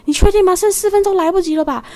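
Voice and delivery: high, loud disbelieving question; you can't be serious, four minutes?; incredulous driver. Retold or imitated. Taxi driver doubting the passenger, high and incredulous.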